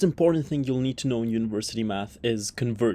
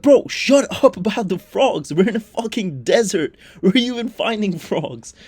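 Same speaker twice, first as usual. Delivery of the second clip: exasperated shout; 5 semitones higher, 5 dB louder than usual. Retold or imitated. Time traveller yelling at the farmer about frogs, exasperated shout. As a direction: bellow it in disbelief. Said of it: "And you're like".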